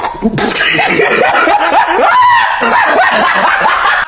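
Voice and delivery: loud, high-pitched cackling laugh; huge shrieking laugh; loud laughing outburst. Real, not acted. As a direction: burst into a long, shouting laugh. Bursts out laughing mid-story.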